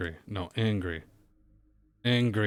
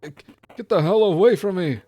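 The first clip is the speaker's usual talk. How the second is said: much higher, loud scared yell with a crack; 9 semitones higher, 11 dB louder than usual. Acted. Frightened old man yells at the delinquent, voice cracking. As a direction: let the voice break in panic. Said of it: "His voice cracks".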